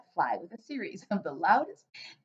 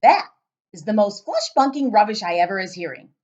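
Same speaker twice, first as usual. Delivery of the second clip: loud giant voice; 11 dB louder than usual. Acted. Giant questioning the girl, booming and puzzled.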